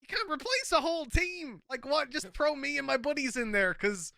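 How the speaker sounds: high-pitched incredulous rant; rant, pitch shooting up in disbelief; exasperated rant. Real, not acted. Ranting at league decision.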